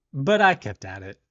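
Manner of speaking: usual speaking voice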